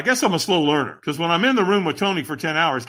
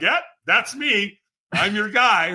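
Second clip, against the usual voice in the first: higher-pitched mock bragging; playful boast; 7 semitones higher, slower than usual. Someone who just did something awesome, playfully boasting. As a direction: play it proud and cocky, pitched up. Proud of a cool feat.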